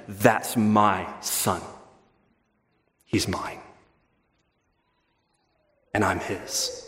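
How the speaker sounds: slow, soft, hushed declaration; tender vow. Retold or imitated. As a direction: say it slowly and tenderly, almost to yourself. Father recalls claiming adopted son.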